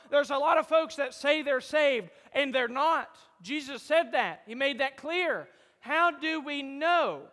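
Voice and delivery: fervent sermon voice; preaching. Acted. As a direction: rouse the congregation with the question.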